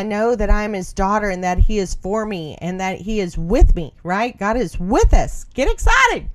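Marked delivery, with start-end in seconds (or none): 5.5-6.3 sudden high excited burst; get excited. Real, not acted. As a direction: jump way up in pitch, cheering them on. Urging listeners to rejoice.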